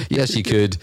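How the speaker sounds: usual speaking voice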